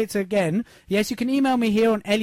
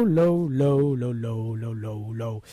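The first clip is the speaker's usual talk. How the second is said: going low; 9 semitones lower, much slower than usual. Shown as a demonstration. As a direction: sink the voice down with every low. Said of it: "I always go low".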